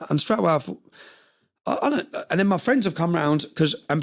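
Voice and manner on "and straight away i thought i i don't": ordinary talk, nothing marked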